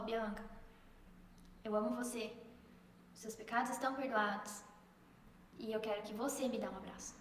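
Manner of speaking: low, soft, spaced-out reassurance; tender quote of Jesus. Retold or imitated. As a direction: offer love and forgiveness gently, pausing between phrases. Jesus's tender words retold.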